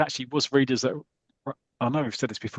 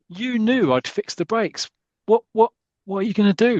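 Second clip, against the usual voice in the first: fearful question; 9 semitones higher, 4 dB louder than usual. Acted. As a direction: ask it dreading the answer. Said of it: "very dramatic".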